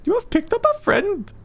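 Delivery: put-on Swedish accent. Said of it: "fall back into my Swedish accent".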